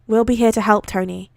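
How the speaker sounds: ordinary delivery, nothing marked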